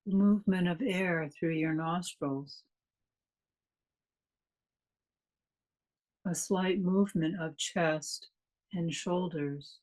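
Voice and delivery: calm, unhurried meditation guide with long pauses; measured meditation delivery, leaving space after each phrase; guided meditation. Real, not acted. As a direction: lead listeners gently into stillness, phrase by phrase.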